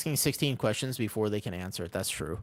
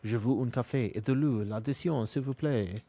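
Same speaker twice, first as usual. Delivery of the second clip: put-on light French accent ordering coffee; French phrase in a French accent. Streamer tries ordering in French with a French accent. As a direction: order café and water like a Parisian, lightly accented. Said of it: "Just with a French accent".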